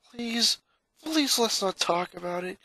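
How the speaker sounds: a hint of distress; pleading boy. Boy begging his sister not to mention the incident, pleading.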